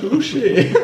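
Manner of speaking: high laughing Touché. Real, not acted. Laughing after joke.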